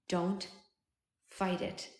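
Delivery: hushed, halting last words; faint dying whisper. A narrator's reading. Dying man's last words to himself, faint whisper. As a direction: let it out very softly, pausing. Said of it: "I heard a whisper".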